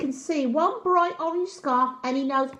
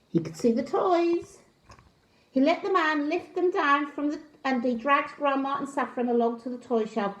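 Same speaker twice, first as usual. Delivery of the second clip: plain reading voice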